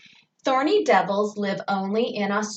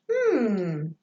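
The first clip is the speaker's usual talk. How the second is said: pondering hum, lightly acted; a drawn-out thinking "hmm"; Eli. Teacher imagines another pupil puzzling over the clue, lightly acted pondering. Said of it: "And Eli going".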